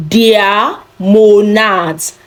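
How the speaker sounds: Der Monat, very slow and drawn out; slow German model. Shown as a demonstration. Teacher drills class on der Monat, slow. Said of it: "singular month in German is der Monat".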